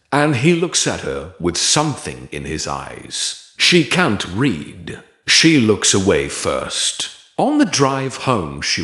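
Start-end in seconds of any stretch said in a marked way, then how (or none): none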